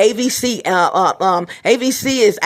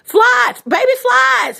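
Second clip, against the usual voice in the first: shout; 13 semitones higher, 3 dB louder, slower than usual. Real, not acted. Frustrated outburst about flies.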